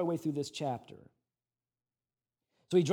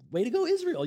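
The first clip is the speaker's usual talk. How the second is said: higher, cheering voice; mock cheer; 10 semitones higher, faster than usual. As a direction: cheer them on, much higher. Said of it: "They act like".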